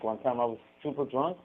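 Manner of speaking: ordinary talk, nothing marked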